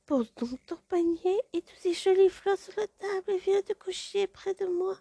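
softened voice. Acted. Wolf invites the girl to lie beside him, softly coaxing. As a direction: coax her into bed gently. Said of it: "ses efforts pour adoucir sa voix".

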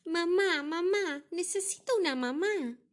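high, pleading little bird's cry. Acted. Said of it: "empezó a llorar".

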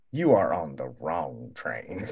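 slow, low, dry correction; deadpan stranger. Retold or imitated. Railroad worker telling the lost hopper he's on the wrong train, slow and low. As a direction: drop the voice low and slow like a weary local setting him straight.

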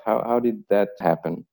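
ordinary talk, nothing marked

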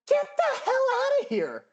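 very high-pitched cry of disbelief; incredulous outburst. Real, not acted. Fan scoffing at owner's wish list, incredulous outburst. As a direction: squawk it, pitch shooting way up.

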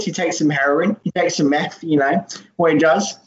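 ordinary talk

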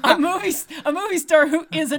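loud laughing words. Real, not acted. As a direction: laugh while fixing it. Laughing through the correction.

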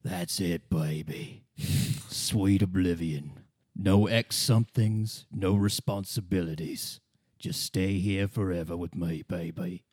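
low, soft seductive croon; sweet nothings. Acted. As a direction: murmur it like a lover. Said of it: "whispering sweet nothings in your ear".

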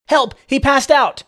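high, loud cry for help; child's yell. A narrator's reading. Child calls help for fainted boy, alarmed yell. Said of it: "one child yelled".

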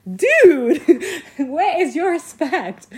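high and loud, laughing through it; amused indignant outburst; laughing protest. Retold or imitated. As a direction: laugh in disbelief at him. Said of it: "And I'm just there like".

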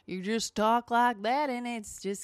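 demonstrating a Georgia accent; southern accent. Shown as a demonstration. Explaining the trick to the listeners, southern accent. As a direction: talk in a stretched-out Southern accent. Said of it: "the trick with the southern accent".

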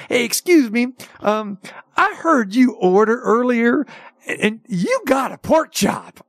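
mild imitation of an old Texan man's accent; imitating Texas accent. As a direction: lightly put on a friendly Texas accent. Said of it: "that, that Texas accent".

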